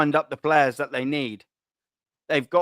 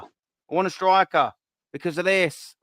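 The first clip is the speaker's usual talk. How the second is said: normal talking voice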